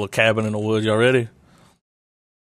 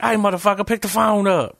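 much higher, mock angry phone voice; 9 semitones higher than usual. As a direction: bark it like a rude phone assistant.

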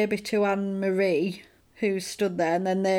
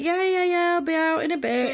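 higher, louder mock husband brushing her off; husband in the toilet dodging his wife, higher and quick; fobbing her off; 9 semitones higher, 3 dB louder than usual. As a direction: rattle it off to get rid of her. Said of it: "And he's like".

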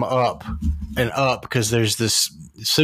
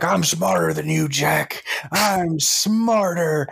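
high-pitched taunt, last word drawn out; imitated gloating villain; 6 semitones higher, much slower than usual. Villain wrestling the hero atop the subway, mock gloating. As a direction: crow it like a movie villain, ending slowly. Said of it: "he's like".

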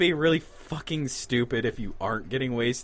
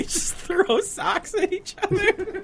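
laughing while talking; words broken by laughter; slower than usual. Real, not acted. Laughing through joke.

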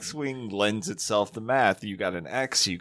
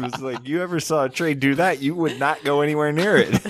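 ordinary talk

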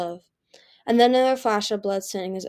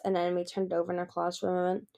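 ordinary delivery, nothing marked

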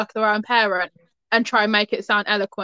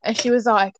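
ordinary talk

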